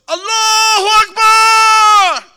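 long, very loud high scream; screamed Allahu Akbar. Retold or imitated. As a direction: scream it to stop the preacher. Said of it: "He screamed so loud".

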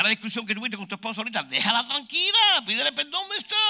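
loud comic exasperation; exasperated scolding. Acted. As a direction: chew out a stubborn husband. Scolding husband after an argument.

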